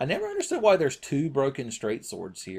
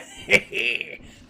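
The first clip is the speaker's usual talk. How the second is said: breathy laugh; 5 semitones higher, 4 dB louder than usual. Real, not acted. Laugh after taunt.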